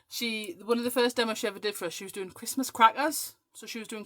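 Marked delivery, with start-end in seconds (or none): 2.3-3.4 imitating a friend's accent on "crackers"; lightly put-on accent, rising on "crackers"; Michelle Johnson. Telling how she demoed Christmas crackers, in a mimicked accent. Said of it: "I do her accent back at her".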